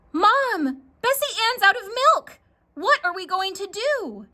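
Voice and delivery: put-on high, fast alarmed girl's cry; panicked child. Jackie telling her mom the cow is dry, high and panicked.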